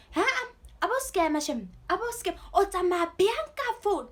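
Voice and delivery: high, loud mock scolding; imitated parental scolding. Parents scolding their child over a lost phone, mock scolding. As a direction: imitate outraged parents telling you off. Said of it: "they started scolding at me".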